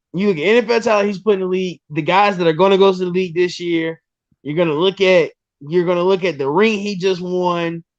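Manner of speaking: normal talking voice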